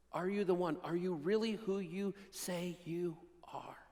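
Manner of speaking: a soft, unvoiced, searching question; a doubting prisoner whispering his fear; hushed doubting whisper. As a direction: whisper John's doubt, barely voiced. Preacher voices John's doubt.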